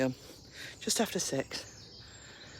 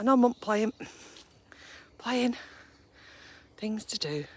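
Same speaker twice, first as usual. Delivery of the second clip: lightly voiced stubborn dog, a bit higher; dog's voice; 7 semitones higher than usual. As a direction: speak for the dog, mildly higher and busy. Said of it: "it's like".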